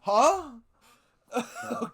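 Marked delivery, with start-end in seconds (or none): 0.0-0.5 confused huh. Retold or imitated. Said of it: "And I'm like".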